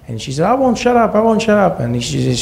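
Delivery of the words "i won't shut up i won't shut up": high mock shout. Mom screaming at the man who hit her, hammed-up outrage. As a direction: shriek it as the defiant mother.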